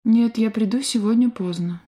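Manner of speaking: ordinary talk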